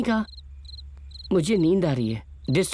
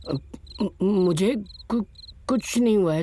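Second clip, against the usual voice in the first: nervous stammer; 3 semitones higher than usual. Acted. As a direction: stumble over the first syllables, covering fear. Frightened man denies anything is wrong.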